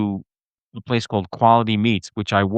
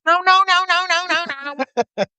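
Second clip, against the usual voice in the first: high, shrill-pitched mock lady saying no; frantic lady refusing. Imitating the lady who stole his cab, high and frantic. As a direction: squeal it like the lady blocking the cab.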